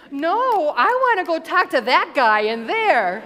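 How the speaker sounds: put-on loud, high toddler protest; imitating a child's insistent wail; upset little girl. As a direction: whine it out like a thwarted three-year-old. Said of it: "she was upset with me".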